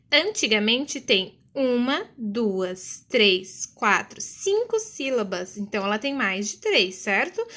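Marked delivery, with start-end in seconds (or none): none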